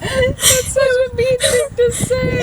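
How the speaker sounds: high, loud-ish stretched words through laughter; laughing sympathy. Real, not acted. Friend reacting to the mean remark, laughing. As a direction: wail it while cracking up. Cracking up at retold insult.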